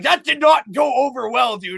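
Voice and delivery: high, loud irritated complaint; annoyed shout. Retold or imitated. Bandmate complaining after the show, loud and high. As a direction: scold him for the failed stunt. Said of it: "He's like".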